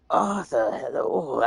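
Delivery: lightly voiced actor impression; half-successful attempt at a put-on greeting; Tom Hardy impression. Retrying the impression for a friend, mild Tom Hardy voice. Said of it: "I can do it better sometimes".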